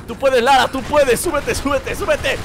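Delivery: ruego agudo que sube a grito; aliento angustiado. Real, not acted. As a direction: apremia a Lara, cada vez más desesperado. Anima a Lara a trepar. (súplica aguda y urgente)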